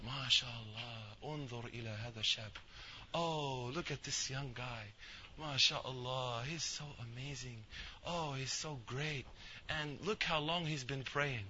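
hushed, admiring murmur from the back rows; murmuring old men. Retold or imitated. Old men in the back murmuring praise of the worshipper, soft and admiring. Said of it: "they were saying".

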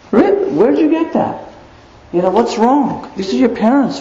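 high, mocking bratty kid voice; imitating a kid talking back; mock teen. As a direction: play the sassy teenager.

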